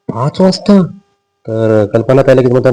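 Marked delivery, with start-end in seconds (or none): 0.1-0.8 surprised. Retold or imitated. Arjun shocked again at hour.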